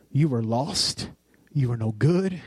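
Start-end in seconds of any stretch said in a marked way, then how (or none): none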